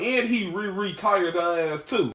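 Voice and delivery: ordinary delivery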